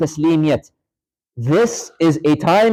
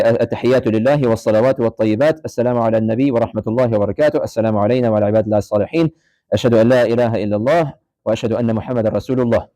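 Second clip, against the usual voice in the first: rapid, lower prayer recitation; fast recitation; 5 semitones lower, much faster than usual. Shown as a demonstration. Teacher showing students the tashahhud, fast recitation. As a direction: rattle off the Arabic like daily prayer.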